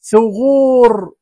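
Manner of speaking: "ثغور" slow and loud, oo held; long oo vowel. Shown as a demonstration. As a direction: stretch "ثغور" as thughooor. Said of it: "كل هذه أو". ("ثغور" ببطء مع مدّ الواو)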